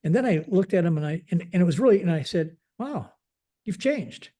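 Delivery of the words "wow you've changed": soft, high-pitched amazed wow; surprised. Retold or imitated. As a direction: let the wow float up high, quietly astonished. Amazed at the airman's change.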